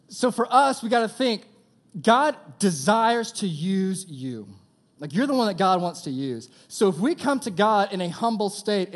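loud, high, fervent preaching. Acted. Preacher urging the youth to trust God, loud and high. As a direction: drive the point home to the crowd.

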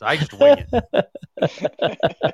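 slow words through laughter; laughing. Real, not acted. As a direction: laugh out the line. Joking he does no work.

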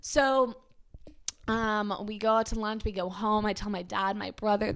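normal talking voice

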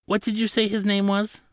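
high-pitched, anxious question; timidly ask, afraid of annoying them; nervous. A narrator's reading. Attendant asking a stern counsel for a name, nervous. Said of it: "the man asked nervously".

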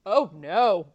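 loud, drawn-out cry of dread; retold dismay. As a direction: blurt it out loudly as if dreading what comes. Retold dread at the sad film.

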